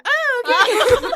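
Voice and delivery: "Oh, okay" squeaked high and loud through a laugh; a loud, slow, laughing mock "oh, okay"; high mock realisation. Passenger hearing the pilot switch to English, squeaky laughing realisation. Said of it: "and I went".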